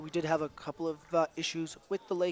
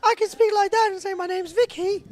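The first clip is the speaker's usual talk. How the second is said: high, louder put-on girly voice; mock feminine voice; 11 dB louder than usual. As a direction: ham up a girly voice to introduce yourself. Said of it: "trying to make my most feminine voice".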